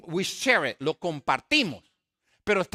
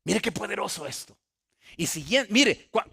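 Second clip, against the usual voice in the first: ordinary talk, nothing marked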